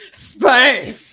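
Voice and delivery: mock-dramatic film villain outburst; Tim Curry. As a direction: burst it out as a theatrical parody. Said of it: "Odes to Tim Curry saying space".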